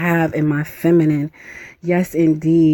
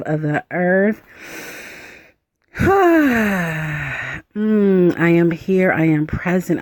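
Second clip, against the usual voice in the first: guided meditation. Acted. Guiding listeners through a ritual, in a gentle meditation voice.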